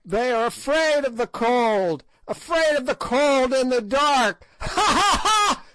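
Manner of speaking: high cackling hag, laughing through the words; shrill witch's laughter mixed into speech; ghastly merriment. A narrator's reading. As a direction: gloat and cackle over the dark. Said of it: "writhed in ghastly merriment".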